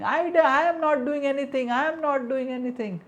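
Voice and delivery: high, loud protest of innocence; shrill-pitched loud denial; shouting, yelling. Acted. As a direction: yell it out like a child running away. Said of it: "And shouting, yelling".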